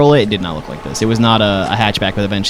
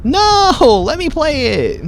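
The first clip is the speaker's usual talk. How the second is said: high whine, no; childish squeal of protest; mock whiny protest; slower than usual. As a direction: pout at the game. Protesting game stopping him.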